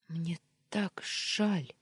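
hoarse tearful whisper, slow; whisper sobbing. Acted. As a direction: breathe it out through sobs, slowly. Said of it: "всхлипнув, отозвалась я хриплым голосом".

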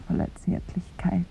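soft whispered compliment; tender whisper. Acted. Woman whispering compliments to the visitor. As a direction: caress the words.

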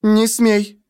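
high-pitched angry command; indignant warning. A narrator's reading. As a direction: stop him short, outraged. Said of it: "она ткнула в меня пальцем". (возмущённо, высоким голосом)

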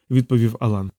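usual speaking voice

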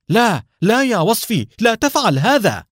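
desperate high loud shout. A narrator's reading. Nour shouting to stop Wasfi shooting, loud and high. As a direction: cry out to stop him. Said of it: "وصاح نور".

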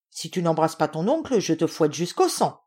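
ordinary delivery, nothing marked

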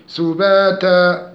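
slow سباتا, long a vowels held two beats; chanted سباتا; natural madd. Shown as a demonstration. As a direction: stretch سباتا evenly. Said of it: "مد طبيعي حركتين".

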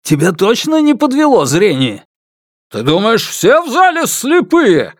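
high, incredulous angry retort; enraged. A narrator's reading. Father answers the man who doubts him, enraged. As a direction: throw the question back in high-pitched anger. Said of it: "выпучились от гнева".

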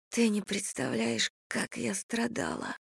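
soft breathy whisper, slowing. Acted. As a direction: whisper it, aching. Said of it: "прошептала я".

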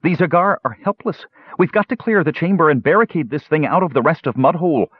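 high, pressing voice; urgent alarm. A narrator's reading. Parian urging Flint to barricade the beast, urgent. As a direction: urge action with the pitch held up.

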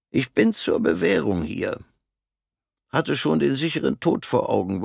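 höhere, schlichte Stimme eines kleinen Mannes; kleiner Mann. Acted. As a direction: als harmloser kleiner Kerl von seinem Schicksal erzählen. Der kleine Mann erzählt. (der kleine Mann erzählt Fritz von der Front, mit höherer Stimme)

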